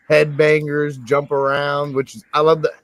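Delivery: ordinary talk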